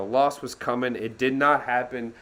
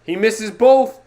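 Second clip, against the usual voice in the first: stunned shout; 7 semitones higher, 9 dB louder than usual. Real, not acted. Watching a missed free throw.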